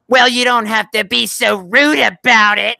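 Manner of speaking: high, loud, drawn-out indignant whine; hammed-up offended outburst; mock flipping out. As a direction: imitate a nice guy losing it. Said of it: "he flips out on her".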